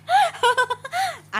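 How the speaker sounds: very high, giggling cackle; cackling laugh. A narrator's reading. Junnu laughing at scared Ananya, high cackling giggle. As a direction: burst out laughing at the prank. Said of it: "అని పకపక నవ్వుతూ".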